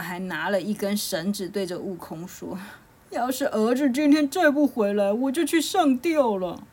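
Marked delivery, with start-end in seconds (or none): 3.1-6.6 high, loud, melodramatic lament; distraught pig father. A narrator's reading. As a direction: wail the threat like a desperate father. Grieving Bajie threatens to hang himself.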